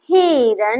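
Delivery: हिरन stretched, with the final न hummed long; drawn-out reading. Shown as a demonstration. Teacher reading the spelt-out word to children, drawn out. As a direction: read हिरन slowly, holding its last sound. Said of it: "इसी तरह इसको पढ़ेंगे".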